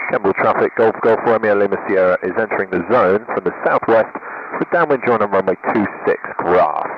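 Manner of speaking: steady formal radio transmission; radio call. Real, not acted. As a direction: rattle off the call sign like a pilot on the radio. Pilot radio call to airfield.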